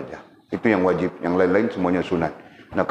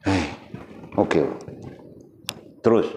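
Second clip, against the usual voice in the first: ordinary talk, nothing marked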